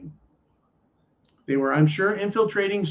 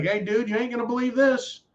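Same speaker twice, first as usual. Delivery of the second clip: put-on hillbilly Southern accent; 7 semitones higher than usual. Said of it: "my favorite hillbilly Southern hick, uh, accent".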